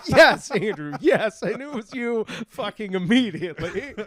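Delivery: laughing exasperated reply. Retold or imitated. Mock exasperated reply to friend.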